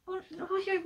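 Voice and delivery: usual speaking voice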